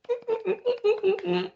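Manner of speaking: high, closed-mouth tittering; mock giggle. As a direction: let out a string of little high giggles. His reaction as she leaves.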